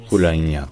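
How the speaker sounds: Bologna said whole as "bu-LA-nya"; Emilian pronunciation. Shown as a demonstration. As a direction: stress an open a in the middle of Bologna. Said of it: "en émilien".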